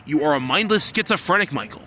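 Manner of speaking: shouting the insult at Michael; angry shout. Real, not acted. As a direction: spit the insult at him. Berating Michael in a rising rant.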